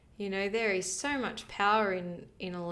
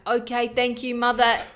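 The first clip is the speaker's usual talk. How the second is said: loud, noble farewell speech; Spartan placenta farewell; 10 dB louder than usual. Acted. Placenta thanking the mother before detaching, loud noble farewell. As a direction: salute the mother before departing. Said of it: "All placentas sound like that".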